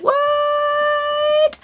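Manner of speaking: long, very high-pitched squealed "what"; mock high-pitched squeal of astonishment; retold disbelief. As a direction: squeal it in stunned disbelief, drawn out. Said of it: "it was just like".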